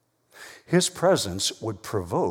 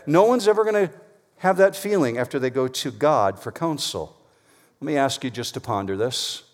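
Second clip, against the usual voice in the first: ordinary delivery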